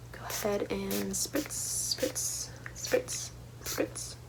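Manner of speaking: soft whispered spritzes; ASMR style. Real, not acted. Host spraying water to cleanse the space, ASMR whisper. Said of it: "A S M R style".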